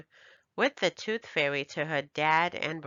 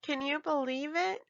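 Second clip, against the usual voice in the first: put-on high, bright-eyed child voice; a proud little girl showing off her money; child's voice, higher; little girl Ebony; 8 semitones higher than usual. A narrator's reading. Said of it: "Ebony asked".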